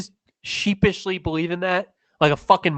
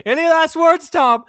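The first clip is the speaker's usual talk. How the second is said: high, taunting executioner; 10 semitones higher, 4 dB louder than usual. Acted. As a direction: taunt the condemned in a raised voice. Acted execution scene.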